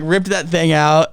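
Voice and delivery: ordinary talk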